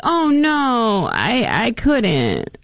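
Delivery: lightly voiced fake modesty; hammed-up false humility; mock Kamala. Host mocks Kamala feigning reluctance to Joe. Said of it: "she's trying to make them like".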